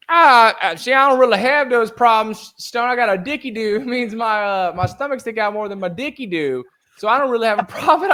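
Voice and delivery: mock Kevin in a clumsy country accent; bad country accent. Imitating Kevin for the podcast hosts in a bad country accent. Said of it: "I can't do a good country accent".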